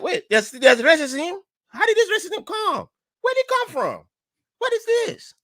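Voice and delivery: very high, astonished questioning; incredulous high-pitched alarm; shocked disbelief. Acted. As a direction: act out stunned confusion, voice shooting up. Acting newcomer's shock at meeting racism.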